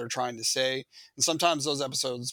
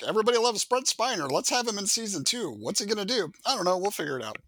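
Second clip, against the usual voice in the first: breezy, higher executive voice; lightly voiced careless producer; mock studio executive; 6 semitones higher than usual.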